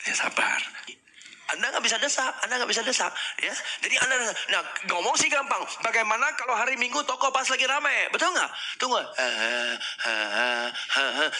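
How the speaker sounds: ordinary delivery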